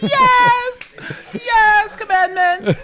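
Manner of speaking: high, loud, laughing cry; hammed-up cheering. Cheering a friend's mock prophecy, high and loud. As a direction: cry out like a delighted disciple.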